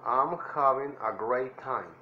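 "I'm having a great time" slowly, stress on "having"; drawn out for copying; slow teacher demo; slow model phrase. Shown as a demonstration. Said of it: "traten de imitar mi voz".

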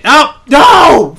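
high, loud cry of dismay; shout of dismay. Real, not acted. Gamer dying after all that effort, loud dismayed shout. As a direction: shout it as you die. Character dies.

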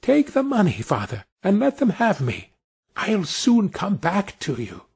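put-on a tiny boy confiding in his father's ear; Tom whispering. A narrator's reading. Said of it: "whispered in his ear".

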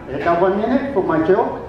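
usual speaking voice

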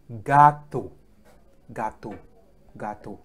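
gâteau as "ga-toh", repeated with falling volume; French gâteau. Shown as a demonstration. Teacher drilling the word for cake, gâteau as "ga-toh". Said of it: "we say".

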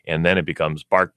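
ordinary talk, nothing marked

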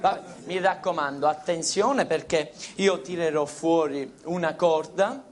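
soft, slow hypnotist voice; voce da ipnotizzatore. Acted. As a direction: lull her into a trance, softly. Ipnotizza la signorina. (voce ipnotica, lenta e sommessa)

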